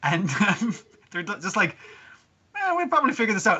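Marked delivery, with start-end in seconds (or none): 2.6-3.6 high, fast, breezy dismissal; quick and high, shrugging the problem away; casual shrug. Retold or imitated. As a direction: toss it off like it's no big deal. Party shrugging it off.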